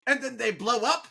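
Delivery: high-pitched cartoon villain's question; Skeletor. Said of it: "Skeletor's like".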